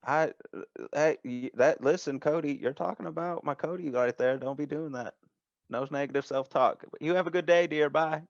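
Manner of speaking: high folksy voice giving a pep talk, then signing off; motherly shop owner. Acted. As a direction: chide a dear friend down the phone. Game master plays Denise encouraging Cody.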